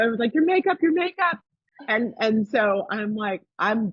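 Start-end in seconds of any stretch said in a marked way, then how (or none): 0.3-1.4 high-pitched retold fretting; playful imitation of alarm; mock alarm. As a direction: fret in a high acted voice. Acted alarm over ruined makeup.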